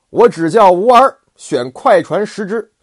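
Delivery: 高亢洪亮、放慢的武将口吻; 像关公一样傲气十足地拍板; 关二爷傲然. Acted. 关羽部署. (关羽对儿子关平豪言，高亢傲然)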